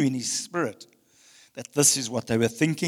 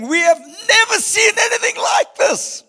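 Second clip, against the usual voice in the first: much higher, louder, slower cry of wonder; amazed crowd shout; 10 dB louder than usual. Acted. Voicing the amazed crowd praising God, high slow shout.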